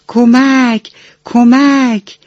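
a pretend drowning cry, high but not screamed; calling for help. A narrator's reading. Drowning pepper calls for help.

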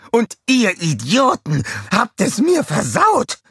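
high, loud, wounded tirade; raging rant. A narrator's reading. Scarlett mourning her ruined coronation, raging rant. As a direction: carry on ranting, peaking at the end. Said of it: "tobte Scarlett weiter".

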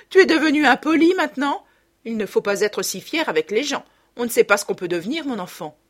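high, loud indignant scolding, fast; scolding mother. A narrator's reading. Mother scolding her son for being rude. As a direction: tell him off sharply.

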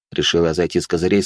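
usual speaking voice

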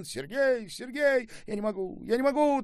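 very high, loud hysterical cries; shaking, overwhelmed. A narrator's reading. Trembling mother clutching her returned son, overwhelmed crying out. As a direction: build to an overwhelmed outcry. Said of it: "тряслась женщина".

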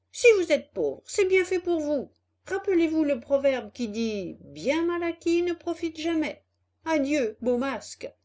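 high, loud, mocking dismissal; scornful rebuff. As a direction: send the swindlers packing. Puppet scornfully rejects the swindlers.

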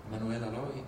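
usual speaking voice

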